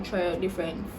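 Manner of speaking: ordinary talk, nothing marked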